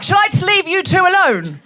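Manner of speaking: high, loud mock outrage; indignant wife. Wife catching husband with Alexa, indignant mock shout. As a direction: shout it up high, acting affronted.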